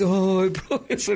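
a hint of the ex-student greeting his old teacher with a laugh; former student's greeting. Ex-student answering his old professor's call, a light laughing imitation. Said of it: "agora falando desse jeito".

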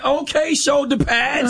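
high, slow, drawn-out call of a nickname; casual call-out. Retold or imitated. Radio host mocking how people call out to a stranger, high and drawn out. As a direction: sing out the nickname across the room. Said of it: "We will reduce people to whatever".

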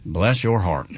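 low, patronizing "Bless your heart," like patting a head; condescending bless your heart. Shown as a demonstration. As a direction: talk down to them with mock pity. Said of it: "But when it is like".

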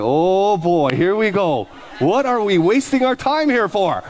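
slow, high, drawn-out mock despair; exasperated dread. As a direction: stretch it out in theatrical exasperation. Acts exasperated reaction to the answer.

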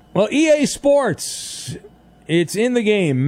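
high, slow booming slogan voice; EA Sports announcer. Retold or imitated. Host parodies the EA Sports intro as a slow booming announcer. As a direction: drag out the slogan like the game intro.

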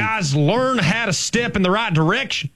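high-pitched, twangy Southern coach; hammed-up exasperated coach chewing out linemen. Host scolding imaginary linemen, mock Southern coach voice. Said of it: "do a proper Southern accent".

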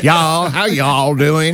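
slow, drawn-out mock Southern drawl; put-on American South greeting; Southern US accent. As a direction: imitate a Southerner, stretching every word. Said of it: "where they speak like that".

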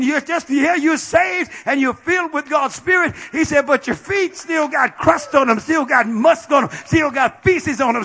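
loud high preacher's cry; full-force preaching. Real, not acted. Preacher rallying the congregation, at full force. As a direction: proclaim it to the saints.

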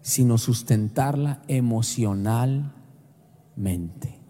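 low, slow and solemn; the Holy Spirit speaking solemnly; voice of God. Retold or imitated. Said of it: "el Espíritu Santo me habló tan fuerte".

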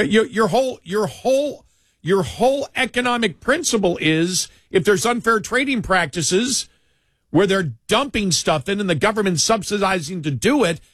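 high-pitched, indignant objection; incredulous protest. Acted. Beef producers protesting to the president, indignant and incredulous. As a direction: push it up high, appalled and arguing. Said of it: "the beef producers went".

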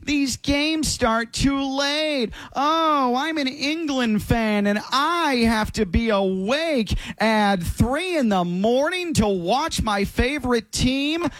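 high, whiny complaining voice; nasal mock whine; mock whining England fan. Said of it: "when you do an impression of someone whining".

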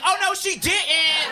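high, drawn-out mock outrage; talk-show audience member. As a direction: react like a scandalized studio audience. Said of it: "an audience member on Maury Povich".

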